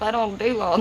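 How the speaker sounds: normal talking voice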